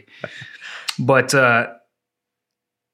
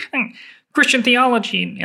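Jordan Peterson impression; 11 semitones higher than usual. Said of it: "act like Jordan Peterson".

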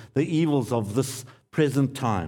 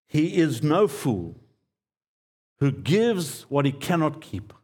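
slow deliberate quote. Shown as a demonstration. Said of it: "Let me say that slowly".

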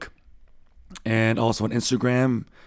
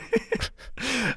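high burst of laughter; 14 semitones higher, 6 dB quieter than usual. Real, not acted. Cracking up mid-sentence.

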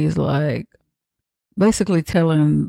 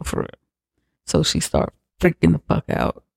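ordinary talk

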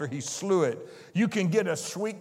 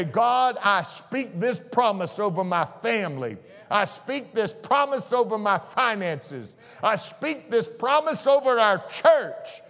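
bold prayer; 4 semitones higher than usual. Acted. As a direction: proclaim it over your family like a prayer warrior. Preacher voices a bold prayer.

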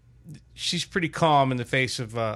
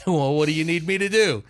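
slightly raised, higher-pitched question; retold exchange; 5 semitones higher, 4 dB louder than usual. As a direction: replay an escalating back-and-forth lightly. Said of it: "we go back and forth like this".

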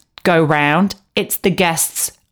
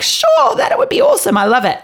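very high, gushing yes; eager Sandy. Retold or imitated. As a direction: squeal it brightly. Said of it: "Sandy's like".

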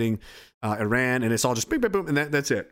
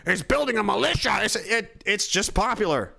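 mock critic; 7 semitones higher than usual. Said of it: "they're like".

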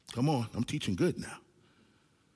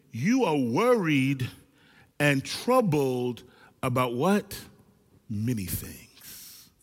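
slow drawn rhetorical question; much slower than usual. Retold or imitated. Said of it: "And he said".

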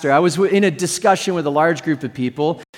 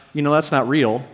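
cynical; 5 semitones lower than usual. Retold or imitated. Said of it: "just that cynical, skeptical".

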